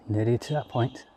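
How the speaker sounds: breathless after climbing steps; winded, catching breath. Real, not acted. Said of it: "excuse me out of breath climbing them steps".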